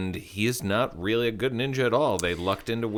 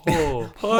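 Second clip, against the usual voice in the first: narwhal voice; 7 semitones higher, 5 dB louder than usual. Pretend. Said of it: "a narwhal's voice".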